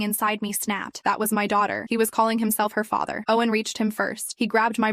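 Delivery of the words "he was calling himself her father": ordinary delivery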